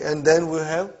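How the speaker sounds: ordinary talk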